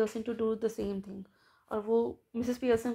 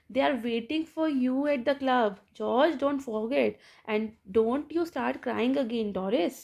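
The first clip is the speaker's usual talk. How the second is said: usual speaking voice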